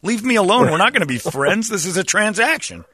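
hammed-up pleading to be left alone; mock exasperation. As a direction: brush off a chatty stranger in comic annoyance. Retold brush-off of chatty locals.

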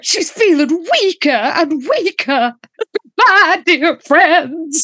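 high, swooning Southern belle; Southern belle act. Acted. Host acting out a fading character, hammy Southern belle. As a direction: play it as a melodramatic, drawn-out Southern belle. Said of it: "Southern Belle act".

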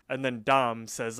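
usual speaking voice

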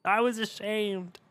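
hammed-up slow shame; mock shame. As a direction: sigh it out like a wounded innocent. Continues mock lament.

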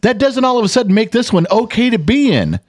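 high, heated comeback; angry retort. Retold or imitated. Father snapping back at the boyfriend, angry. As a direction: put the boyfriend in his place. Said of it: "I said".

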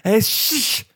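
high shushing, then hushed. Retold or imitated. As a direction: shush someone at a concert. Acting being shushed.

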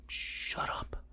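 harsh whispered shut up. Retold or imitated. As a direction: hiss it under your breath. Mom whispering back to kid.

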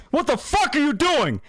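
high-pitched imitation of a furious manager; retold angry yell. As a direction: burst out yelling as the angry boss. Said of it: "he's yelling at me".

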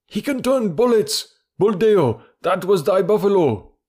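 high, loud crowd outcry; villagers shouting. A narrator's reading. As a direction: shout the accusation at Buldeo. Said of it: "shouted the villagers".

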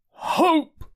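high, scornful single word; incredulous curate. A narrator's reading. Curate scoffing at the narrator's hope, high. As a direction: throw it back high in disbelief.

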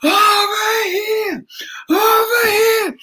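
put-on high, slow, drawn-out calls of an eager girl; eager girl pleading. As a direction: beg to be picked, stretching each word. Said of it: "She's like".

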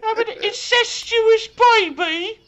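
very high, slow falsetto of a scandalized English lady; falsetto posh lady. Pretend. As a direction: shriek it like a prim old English lady gasping. Mock posh British lady.

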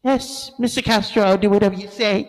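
high, quick, eager woman's voice; smitten woman. Retold or imitated. Barbara voiced in story.

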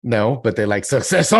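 Succession said the French way, loud with a rising end; mock French accent. Host answering if the French like Succession, in a hammed-up French accent. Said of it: "Do you think the French like Succession".

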